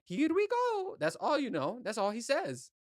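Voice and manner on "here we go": very high cartoon plumber cheer; Mario voice. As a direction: squeal it like the game hero jumping. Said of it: "how do you know what his voice sounds like".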